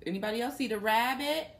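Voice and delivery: usual speaking voice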